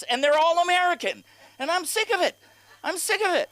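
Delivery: high, loud fed-up complaint; exasperated rant. Acted. Comic fed up with Alexa's upgrade, exasperated. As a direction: pitch it way up in comic outrage.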